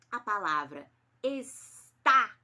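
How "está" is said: "está" slow and loud, the final A wide open. Shown as a demonstration. Teacher demonstrating the acute accent, "está" with a long open A. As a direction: stretch "está" syllable by syllable, opening the last vowel. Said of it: "esse A é bem aberto".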